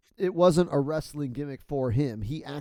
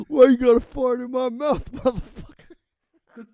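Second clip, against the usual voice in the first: a loud, higher mock shout with laughter breaking in; hammed-up outrage of a huge wrestler; big angry wrestler; 10 semitones higher, 8 dB louder than usual. Picturing the wrestler yelling at the prankster, hammed-up shout. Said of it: "big-ass wrestler".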